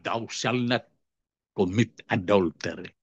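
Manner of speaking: hushed scripture quote. Acted. Preacher quoting the commandment to the congregation, hushed and solemn. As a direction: drop the voice low and soft for the commandment.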